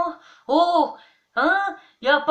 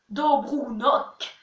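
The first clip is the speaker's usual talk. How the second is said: ordinary talk, nothing marked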